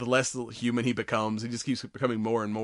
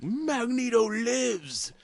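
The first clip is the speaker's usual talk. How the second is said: much higher, slow, grand proclamation; Magneto; 13 semitones higher, much slower than usual. Retold or imitated. Magneto waking up and proclaiming his return, slow and grandiose. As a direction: stretch it out like a triumphant villain. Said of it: "Magneto finally wakes up and he's like".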